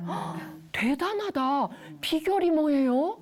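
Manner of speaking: gasp, draw out the praise, then rise to ask; impressed, with a lifted questioning end; admiring question. Shown as a demonstration. Someone asking an achiever their secret, admiring. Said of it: "이렇게 묻는다고 한번 생각해 보세요".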